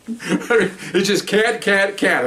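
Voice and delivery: slow, laughing mockery. Real, not acted. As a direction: drag it out laughing at her. Teller mocks her, laughing.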